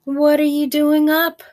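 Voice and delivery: high, loud turkey scolding. A narrator's reading. Reading to kids as the turkey, high and loud. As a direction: squawk the question like a turkey. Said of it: "Turkey said".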